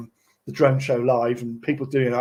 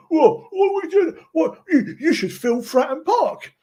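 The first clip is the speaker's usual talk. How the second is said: very high, hammed-up Pompey fan accent; bad Pompey accent; 12 semitones higher than usual. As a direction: imitate a rowdy Pompey lad's accent, pitched way up. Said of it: "Sorry, a bad accent anyway".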